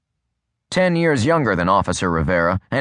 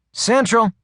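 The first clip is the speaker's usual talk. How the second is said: much higher dispatcher voice; woman dispatcher; 12 semitones higher than usual. A narrator's reading. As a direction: answer briskly as the radio operator.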